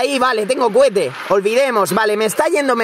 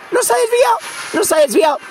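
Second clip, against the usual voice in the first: high, shocked outcry; amazed gamer yell; stunned shout; 13 semitones higher, faster than usual. Real, not acted. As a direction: yell in disbelief at the screen. Disbelief at lucky game moment.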